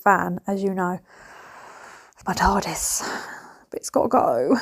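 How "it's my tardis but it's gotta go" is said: whispered, lower, letting go of her beloved car; sad whisper. Real, not acted. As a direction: breathe it out as a heartbroken aside. Sad about selling her car.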